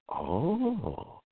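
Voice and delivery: very soft, high, drawn-out oh; dawning realization. Retold or imitated. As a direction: act out quietly getting it at last. Acts out own realization.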